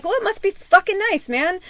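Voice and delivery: high-pitched sarcastic retort; bitter sarcasm. Retold or imitated. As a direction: throw back a resentful, mock-impressed reply. Bitter retort to partner's bragging.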